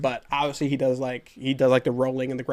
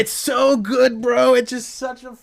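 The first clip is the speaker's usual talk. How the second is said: real excitement; 10 semitones higher, 5 dB louder than usual. Real, not acted. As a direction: rave about it at full tilt. Friend wants to watch, he gushes.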